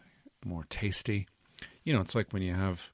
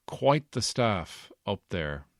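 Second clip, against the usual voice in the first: ordinary delivery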